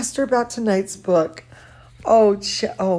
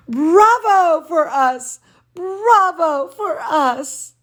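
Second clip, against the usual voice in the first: cheer; 7 semitones higher, 3 dB louder, slower than usual. Acted. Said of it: "then we shout".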